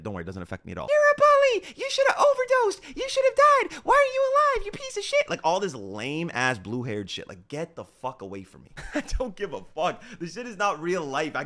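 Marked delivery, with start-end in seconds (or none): 0.9-5.3 very high-pitched, squeaky mock voice; mocking online haters. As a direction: mimic whiny haters in a shrill, loud falsetto. Mimicking online haters' abuse.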